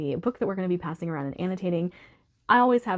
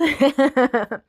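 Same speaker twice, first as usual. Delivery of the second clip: laugh; 5 semitones higher, 7 dB louder than usual. Real, not acted. Laughing at forgetting.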